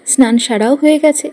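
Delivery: ordinary talk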